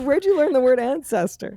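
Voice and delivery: put-on incredulous at the child; mock astonishment. Comic recalling her shock at the child's word. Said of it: "I was like".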